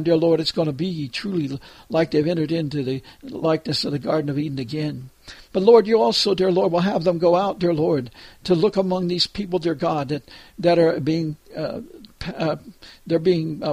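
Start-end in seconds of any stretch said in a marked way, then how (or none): none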